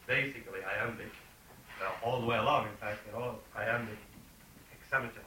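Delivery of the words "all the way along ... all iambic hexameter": ordinary talk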